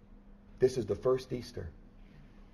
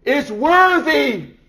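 high, loud, drawn-out angelic cry; 18 dB louder, much slower than usual. Acted. Preacher voicing the angel's loud cry to heaven. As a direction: call it out like a herald angel. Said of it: "an angel cries out".

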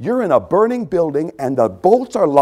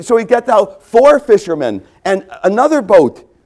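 normal talking voice

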